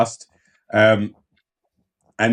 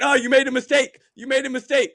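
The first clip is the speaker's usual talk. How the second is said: very high-pitched sing-out of blame; gleeful mock call-out; 13 semitones higher than usual. Viewer catching a dancer's mistake, high mock call-out. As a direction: pounce on the slip, high and emphatic.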